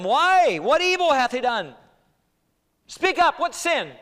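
high shouted challenge to a crowd; loud, urgent demand. Acted. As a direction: cry out in exasperated protest.

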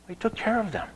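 tender soft echo. Acted. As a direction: repeat it gently and hushed. Softer repeat.

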